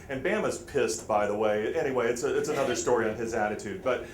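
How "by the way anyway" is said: normal talking voice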